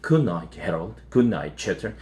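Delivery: soft and low good night. A narrator's reading. Mom bids the pets good night, calm and soft. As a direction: settle the house down gently. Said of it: "and then more calmly".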